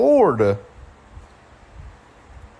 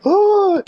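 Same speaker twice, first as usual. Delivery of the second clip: gasp; 13 semitones higher, 3 dB louder than usual. Real, not acted. Surprise at screen.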